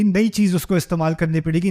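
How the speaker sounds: normal talking voice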